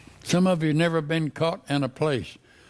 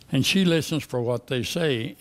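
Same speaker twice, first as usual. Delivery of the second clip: normal talking voice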